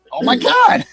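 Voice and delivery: loud, high gasp of awe; stunned at the stunt; amazed. Retold or imitated. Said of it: "And I'm like".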